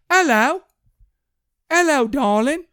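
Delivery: high, slow, hammed-up British come-on; British accent. Mover calling out the window to women, mock British. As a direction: imitate a cheeky British pickup line. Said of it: "he just said that in the British accent".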